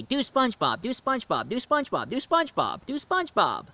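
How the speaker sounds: high-pitched mock chant of begging kids; nagging kids. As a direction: imitate children pestering you, over and over. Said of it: "every single time they always say".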